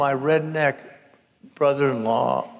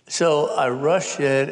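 ordinary delivery, nothing marked

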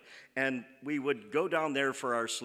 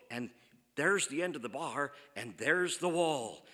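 ordinary talk

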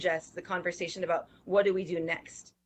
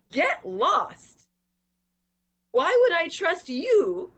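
angry; 5 semitones higher than usual. Retold or imitated. Angry residents retort to agencies.

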